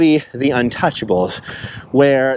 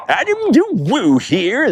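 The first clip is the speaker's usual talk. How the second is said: a slow, higher put-on Adam the Woo voice; Adam the Woo impression; 6 semitones higher, slower than usual. Vlogger imitating his friend's slow, goofy greeting. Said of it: "that's my Adam the Woo impersonation".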